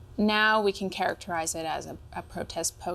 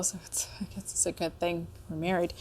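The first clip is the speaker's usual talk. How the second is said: whispered joke; 5 dB quieter than usual. Real, not acted. Joking aside after identical notes.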